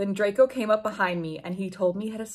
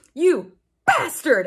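slow, louder, higher mock fury; angry shout; 7 semitones higher, 5 dB louder, slower than usual. A narrator's reading. Heroine yelling at Draco, hammed-up angry shout. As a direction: spit it out like cheap melodrama. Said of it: "I shouted angrily".

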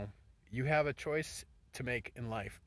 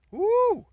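whoop; 16 dB louder than usual. Real, not acted. Cheering God's hand.

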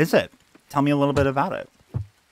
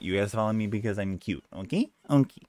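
ordinary talk, nothing marked

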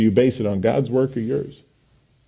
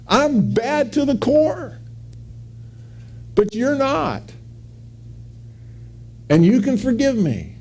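David confessing; 12 semitones higher than usual. Acted.

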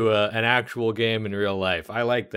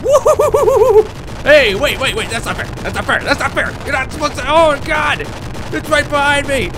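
panicked high shrieking, much louder; scared horror game streamer; 13 semitones higher, 7 dB louder than usual. Real, not acted. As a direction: scream in terror while being chased. Chased by monster in maze.

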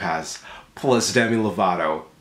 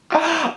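sharp high gasp. Acted. Mock shock at butterfly.

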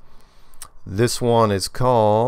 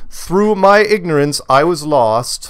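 dramatic; 8 semitones higher, 7 dB louder, slower than usual. Retold or imitated. Reading a listener's dramatic email.

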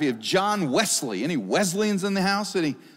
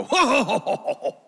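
mock hearty laugh; 6 semitones higher than usual.

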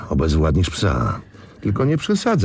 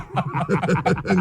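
a burst of gloating laughter; hearty laugh; 11 semitones higher, 3 dB quieter than usual. Acted. Gang boss laughing at the sugar swap. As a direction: laugh at the joke on Nowak.

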